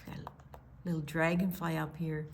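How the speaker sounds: hushed aside; whisper. Real, not acted. Grandma points out the dragonfly to the child, whispered. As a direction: lean in and whisper it.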